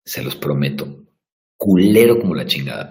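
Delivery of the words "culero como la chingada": louder, punchy exclamation; loud outburst. Real, not acted. Promising viewers, bursts out loud. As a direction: blurt it out loud for emphasis.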